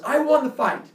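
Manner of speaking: high, loud insistent claim; imitated victory boast. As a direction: insist loudly like a boy who won. Rival boy insists he won.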